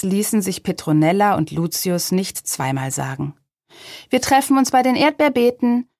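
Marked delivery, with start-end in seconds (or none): 4.1-5.9 quick, higher call. A narrator's reading. Little witch to her beetle before setting off, calling out. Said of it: "rief die Apfelhexe".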